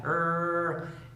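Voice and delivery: "er" held as one long R; super-long R. Shown as a demonstration. Teacher demonstrating the English R, "er" held very long. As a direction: stretch the R of "er" right out. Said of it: "la R sea súper larga".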